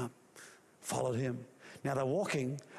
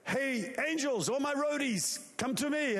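God calling angels; 9 semitones higher than usual. Acted. God summoning his angel roadies, a high booming call. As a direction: summon the angels like a rock star boss. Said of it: "So he said".

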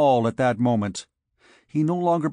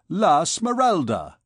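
slow, lilting gaiety in a higher voice; gaily; 5 semitones higher, 4 dB louder than usual. A narrator's reading. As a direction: say the name gaily, savouring it. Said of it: "said Phoebus gaily".

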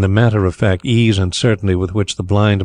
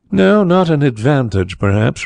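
slow, higher-pitched pondering start; thoughtful; 6 semitones higher than usual. A narrator's reading. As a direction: weigh it up as you answer. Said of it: "replied the other thoughtfully".

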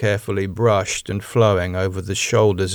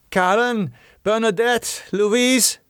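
high-pitched call down the hall; 13 semitones higher than usual. Acted. Rose summoning the women from the hall, calling out. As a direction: sing the names out to summon them. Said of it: "Rose called".

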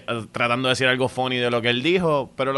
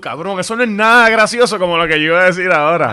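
louder, fast, irritated inner complaint; annoyed comic; 6 semitones higher, 8 dB louder, faster than usual. Retold or imitated. Comic annoyed the heckler stole the laugh, quick and loud. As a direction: grumble it quickly, stung. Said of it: "y tú".

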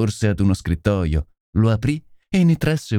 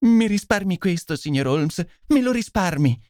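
higher-pitched, distraught pleading; sconvolta e supplichevole; 9 semitones higher than usual. A narrator's reading. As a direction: beg him as an overwrought lady. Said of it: "lo scongiurò sconvolta e supplichevole". (sconvolta, con voce supplichevole)

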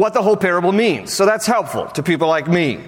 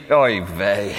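weary Jesus; 8 semitones lower than usual. Retold or imitated. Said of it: "Jesus would have answered me".

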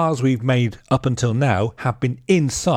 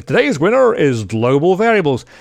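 higher, louder game show host; game show announcer; 5 semitones higher, 5 dB louder than usual. Pretend. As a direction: announce the winner with flair. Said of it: "like a winner".

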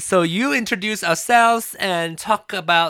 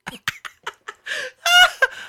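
shrieking laugh; squealing laugh; high laughing squeal. Real, not acted. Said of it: "what did your face change?".

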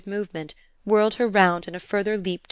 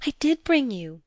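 put-on higher-pitched child's eagerness; eager insistence; 6 semitones higher than usual. A narrator's reading. Girl owning up to her stepparents, eager. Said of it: "with a burst of eagerness".

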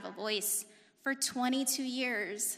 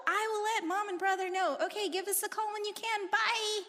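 higher, breezy pretend-normal goodbye; fake casual; 10 semitones higher than usual. Daughter covering for dad on the phone, fake-casual and higher. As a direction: act like nothing happened, pitched up. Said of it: "I was like".

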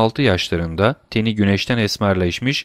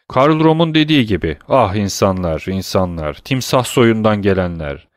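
plain reading voice

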